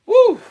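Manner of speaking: excited whoop, very high; loud high woo. Real, not acted. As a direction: cheer it out loud. Excited cheer at sign-off.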